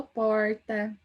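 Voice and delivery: interior paulista accent on "porta"; drawn-out "porta" with a countryside São Paulo r. Shown as a demonstration. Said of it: "e o meu é do interior".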